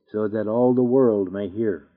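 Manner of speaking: ordinary talk, nothing marked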